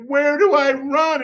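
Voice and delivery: high, mock-panicked cry; flustered question. Lost kid asking the shouting coach, flustered. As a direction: call back high and bewildered.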